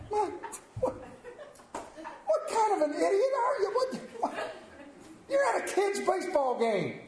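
laughing mock tirade ending in a shout; mock outraged yell. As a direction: ham up your disbelief at the idiot, laughing. Retold inner rant at rude fan.

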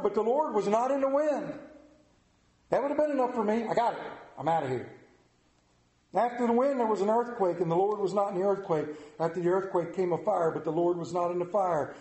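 ordinary talk

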